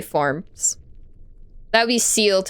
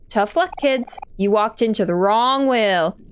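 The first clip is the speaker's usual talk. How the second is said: ordinary talk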